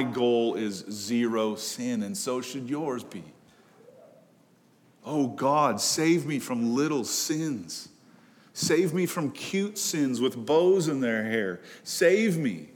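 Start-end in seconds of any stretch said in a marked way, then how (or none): 5.0-12.7 slow, high-pitched plea; pleading prayer. Acted. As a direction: plead with God, drawn out and higher. Acted plea to God about sins.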